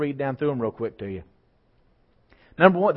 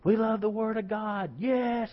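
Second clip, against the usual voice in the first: high, stretched cheer at normal volume; pitched-up rally whooping; mock crowd shouting; 9 semitones higher, slower than usual. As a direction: imitate men rejoicing in a stadium. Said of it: "they're all shouting and jumping up and down".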